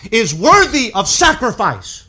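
"is worthy of sacrifice" called out loud and high, slow; proclaimed. Acted. Preacher proclaiming Paul's words, loud and slow. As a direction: ring out "worthy" with force.

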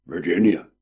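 put-on rough, croaky old sailor. A narrator's reading. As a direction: croak the word out. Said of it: "his friend replied with croaky voice".

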